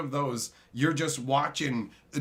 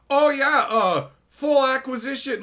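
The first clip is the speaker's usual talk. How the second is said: mock company admission; 10 semitones higher, 7 dB louder than usual. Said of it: "the company's like".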